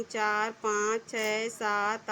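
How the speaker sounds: put-on counting aloud very slowly for children; teacher counting lines slowly so kids follow. Shown as a demonstration. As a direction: draw each number out one by one. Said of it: "अब सबको बच्चों हमको गिनना है".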